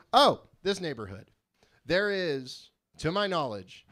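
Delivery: ordinary talk, nothing marked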